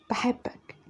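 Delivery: whispered I love you, almost no voice; barely audible confession. Acted. Girl whispering her love to Fahd, voiceless whisper. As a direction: breathe it out so he barely hears. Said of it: "قلت إيه؟".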